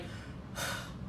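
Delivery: soft, voiceless giving-in sigh; acted sigh of surrender; resigned sigh. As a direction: imitate a reluctant hero breathing out. Hero gives in, retold.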